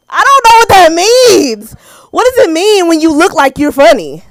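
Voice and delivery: high, loud mock wail; baffled outcry. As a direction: wail the confusion in comic exasperation. Acted bafflement at backhanded compliment.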